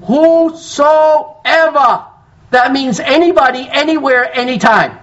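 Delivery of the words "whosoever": "Whosoever" stretched very slowly and higher; drawn-out stress. Shown as a demonstration. Preacher answering his own question, "Whosoever" stretched high and slow. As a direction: lift and hold "Whosoever". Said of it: "what's the next word?".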